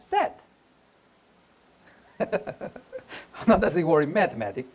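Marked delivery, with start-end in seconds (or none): 2.2-2.7 short, high chuckle; soft little laugh to yourself; amused chuckle. Real, not acted. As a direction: chuckle lightly at your own joke. Amused by own happy-sad formula.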